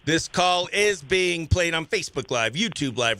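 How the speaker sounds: ordinary delivery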